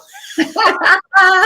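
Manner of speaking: a high, loud burst of real laughter; loud laughing burst. Real, not acted. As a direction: let a big laugh break out.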